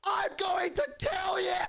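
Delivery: hammed-up outraged mother; furious mom.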